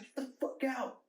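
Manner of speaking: high, strained mock scream; freaked-out yell. Tripping kid repeats order to grandpa, acted high yell. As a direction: re-enact a panicked order, pitch way up. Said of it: "freaked out".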